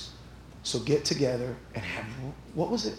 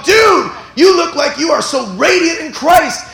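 much higher, louder, slow gushing; excited shout; 14 semitones higher, 14 dB louder, slower than usual. Pretend. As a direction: hype up a friend. Preacher voicing excited churchgoers.

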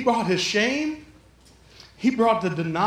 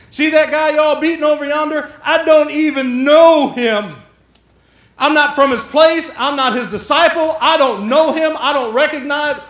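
loud, raised voice, stretching the key words; emphatic denial; 4 semitones higher, 7 dB louder than usual. Acted. Peter insisting to the crowd he doesn't know him, loud denial. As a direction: disown him loudly, line after line.

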